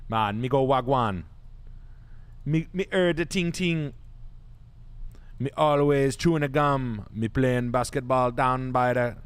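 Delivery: lightly put-on Jamaican patois; hint of a mock Jamaican accent; [name] fake patois. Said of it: "That's your [name] impression there".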